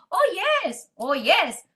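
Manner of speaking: high-pitched celebratory exclamation; delighted excitement. Retold or imitated. Seller getting a five-hundred-bottle order, delighted. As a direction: crow it with mock glee.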